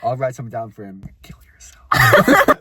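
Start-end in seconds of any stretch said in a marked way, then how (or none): 1.2-2.6 high, slow screeched joke; shrieked fake note for him; mock shriek. Mock reply, shouted.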